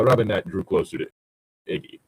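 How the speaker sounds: ordinary talk, nothing marked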